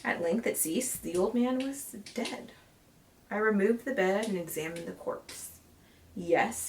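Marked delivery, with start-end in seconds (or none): none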